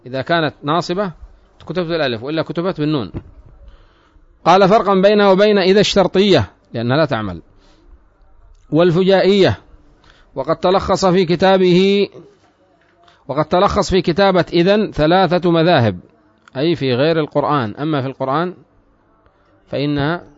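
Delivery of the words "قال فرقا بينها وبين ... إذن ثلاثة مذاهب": higher-pitched, measured reading voice; formal text reading. Acted. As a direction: read the written text out to students. Reads the book text aloud.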